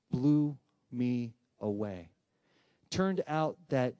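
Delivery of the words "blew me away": drawn-out, very slow "Blew me away" with a falling tune; slow emphatic amazement. Real, not acted. Speaker stresses his astonishment to the audience, slow awed "Blew me away". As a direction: stretch each word of "Blew me away" in awe. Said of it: "we make the realization".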